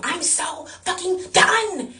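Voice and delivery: high, drawn-out exasperated cry; acted-out fed-up outburst, stretched out.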